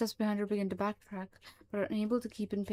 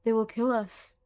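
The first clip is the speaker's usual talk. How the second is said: louder, frightened pleading; fearful; 6 dB louder than usual. Acted. Frightened creature explains itself to Mullins, louder. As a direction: blurt it out in fear. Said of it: "fear in its voice is apparent".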